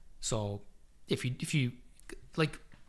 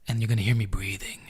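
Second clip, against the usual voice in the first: close to the mic; 6 semitones lower, faster than usual. Shown as a demonstration. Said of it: "or else I'm gonna have to talk like this".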